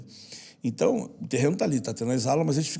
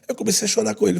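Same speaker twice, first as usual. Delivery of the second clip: ordinary talk, nothing marked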